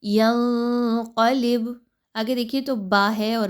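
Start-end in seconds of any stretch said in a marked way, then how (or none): 0.0-1.7 ینقلب with the ن hidden in the nose, very slow; correct ikhfa. Shown as a demonstration. Teacher repeats the correct recitation for students. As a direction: slowly recite ینقلب with nasal hiding. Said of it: "آپ نے یہاں پہ اخفا کرنا ہے".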